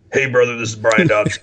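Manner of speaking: Southern Hulk Hogan impression; Brian Dobson. Gym owner answering a fan's phone call, Southern wrestler impersonation. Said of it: "this is me impersonating him".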